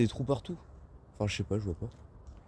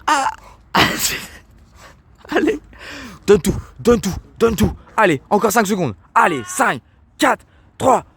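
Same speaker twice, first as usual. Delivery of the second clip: shouting fitness coach countdown; sports coach; 13 semitones higher, 7 dB louder than usual. Pretend. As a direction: yell encouragement like a gym trainer. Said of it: "je suis coach sportif".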